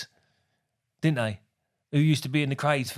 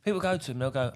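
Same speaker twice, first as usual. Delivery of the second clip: usual speaking voice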